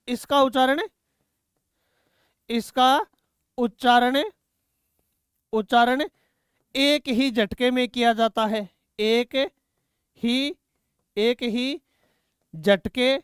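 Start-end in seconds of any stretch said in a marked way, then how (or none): 2.5-6.0 word by word with long pauses; measured classroom dictation. Real, not acted. Dictating words for students to write. 9.0-13.1 each word set apart by a pause; halting, spaced-out classroom dictation. Real, not acted. Dictating words for students to write.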